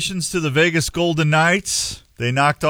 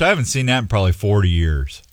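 ordinary delivery

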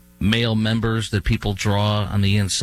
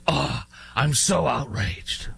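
mock outrage; 2 semitones higher, slower than usual. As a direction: sneer out a fake holier-than-thou gasp. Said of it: "talk like I'm holier than thou".

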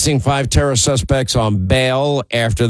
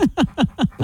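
laugh; 13 semitones higher, 4 dB quieter than usual. Real, not acted. Laughs at co-host's joke.

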